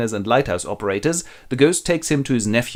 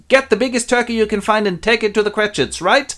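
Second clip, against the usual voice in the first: high-pitched giddy order; overexcited; 9 semitones higher, 3 dB louder than usual. Acted. Scrooge sends a boy for turkey, giddy.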